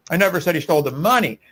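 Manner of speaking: money saved for a strong final punch; emphatic MONEY at the end of the sentence; stress on MONEY. Shown as a demonstration. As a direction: lean on the last word, money. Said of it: "emphasizing different words in that sentence".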